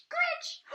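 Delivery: screeching noise. Acted. Reader voicing the frightening screech in the night. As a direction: make the scary sound at the window.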